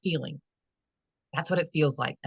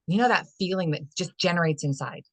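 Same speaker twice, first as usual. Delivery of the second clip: usual speaking voice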